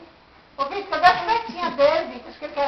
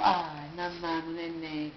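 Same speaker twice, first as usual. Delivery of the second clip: whispering over a crib; 8 semitones lower, 6 dB quieter, much slower than usual. Real, not acted. Soothing baby to sleep.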